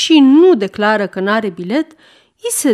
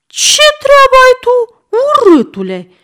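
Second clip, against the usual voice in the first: put-on a rude boy snapping, much higher and louder; bratty child; 8 dB louder than usual. A narrator's reading. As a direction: jerk free and talk back like a spoiled brat. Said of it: "zice mititelul smucindu-se". (copil obraznic care se răstește, mult mai sus și mai tare)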